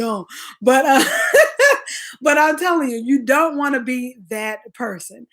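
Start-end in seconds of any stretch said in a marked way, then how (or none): 1.1-2.1 high burst of laughter. Real, not acted. Laughs at own story.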